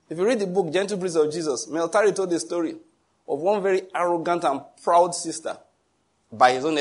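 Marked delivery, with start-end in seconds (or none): none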